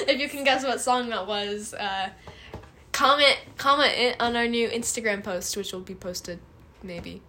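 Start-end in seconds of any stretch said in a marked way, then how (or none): none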